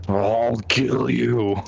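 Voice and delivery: seeing red threat. Acted. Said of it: "seeing Red Mode".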